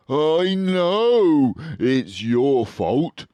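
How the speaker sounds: furious. A narrator's reading. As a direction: snap the blame back at him. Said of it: "said Badger furiously".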